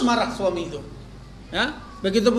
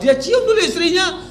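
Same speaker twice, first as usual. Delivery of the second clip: higher and amused, "cium" lifted high with a laugh; delighted emphasis; 7 semitones higher than usual. Shown as a demonstration. Preacher marvelling to the mothers, delighted. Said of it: "repeated for emphasis".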